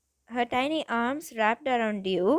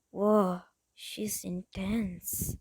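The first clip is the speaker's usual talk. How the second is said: awed murmur; 4 semitones lower, 5 dB quieter, much slower than usual. Acted. Leo's first awed impression of you.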